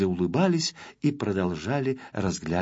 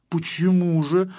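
mildly indignant, higher-pitched protest; 6 semitones higher, 4 dB louder than usual. A narrator's reading. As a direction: object with a touch of indignation. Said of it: "возмутился Тюбик". (слегка возмущённо)

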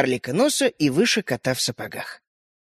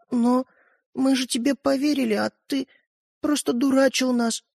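hurt; 6 semitones higher than usual. A narrator's reading. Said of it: "обиженно произнёс Светлик".

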